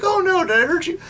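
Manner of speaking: high, sweet cartoon girl gasping in concern; worried Serena voice. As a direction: mimic a caring heroine checking on the cat. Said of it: "And she's like".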